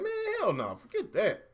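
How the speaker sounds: a high, mock-disgusted "hell no"; scoffing refusal. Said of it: "I was like".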